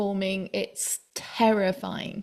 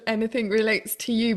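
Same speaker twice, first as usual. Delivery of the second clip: usual speaking voice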